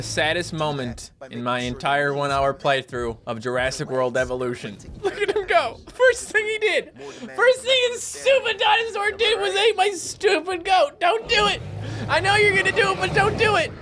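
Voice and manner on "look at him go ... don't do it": very high, hammed-up panic; frantic pleading. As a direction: squeal a desperate plea to stop. Watches dinosaur go for goat.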